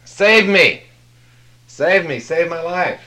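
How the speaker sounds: loud high frantic begging; desperate plea. Retold or imitated. Dangling climber yelling to God, loud frantic plea. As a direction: scream for rescue.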